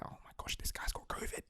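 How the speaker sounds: whispered, voiceless aside; mock whisper. Whispering what an Italian onlooker might think. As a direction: hush it like a gossiping stranger. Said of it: "covid related or like".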